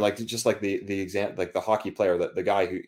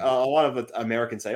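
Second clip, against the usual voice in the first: ordinary talk, nothing marked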